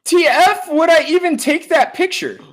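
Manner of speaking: high indignant falsetto; man playing an outraged woman; lady voice. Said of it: "do your best lady voice".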